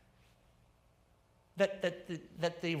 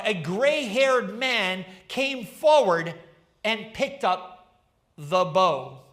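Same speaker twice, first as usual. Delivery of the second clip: slow, louder dramatic poem reading; poem recital; 5 dB louder than usual. Acted. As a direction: let each line land, savouring it. Reads the poem aloud.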